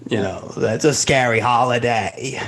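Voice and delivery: lightly done Paul Lynde. Acted. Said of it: "I was trying to get my Paul Lynde voice down".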